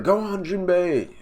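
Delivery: high, drawn-out cheer; acted rallying cry. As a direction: send the captain off with a long high call. Crew cheers captain on.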